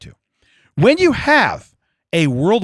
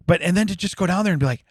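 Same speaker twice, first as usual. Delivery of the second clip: ordinary delivery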